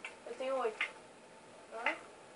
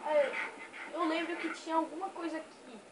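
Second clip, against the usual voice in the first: usual speaking voice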